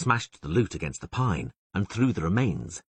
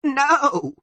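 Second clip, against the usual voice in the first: much higher, louder frightened cry; bleating cry; 8 dB louder than usual. A narrator's reading. Torqua crying out to save the Witcher, high and loud. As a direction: cry out to stop him. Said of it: "bleated Torqua".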